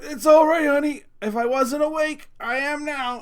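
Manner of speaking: loud, high, strained-patience retelling; mock pained reassurance. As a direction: act out reassuring a kid right after being hurt. Acts out pained reply to daughter.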